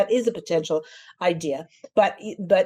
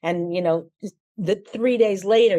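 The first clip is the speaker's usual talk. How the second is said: ordinary delivery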